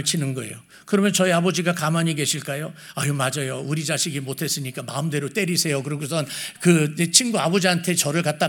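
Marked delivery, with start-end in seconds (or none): 3.0-5.8 low, soft, yielding reply; acted meek deference. Father handing his son over to the neighbour, meek deference. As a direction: give in mildly, voice dropped.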